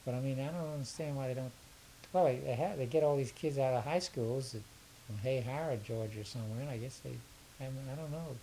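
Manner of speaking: ordinary talk, nothing marked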